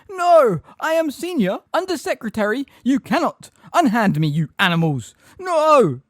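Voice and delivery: high, loud, panicked outrage; Umbridge shrieking. A narrator's reading. Umbridge fighting off centaurs, outraged shrieking. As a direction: shriek orders while being seized. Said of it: "he heard Umbridge shriek".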